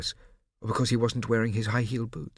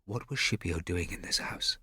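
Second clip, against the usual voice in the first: hushed; 3 semitones lower, 5 dB quieter than usual. A narrator's reading. Anxious inner question.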